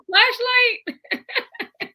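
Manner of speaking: high, laughing rising question; the roommate finally gets it; imitated lightbulb moment, up high; sudden realization. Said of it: "she's like".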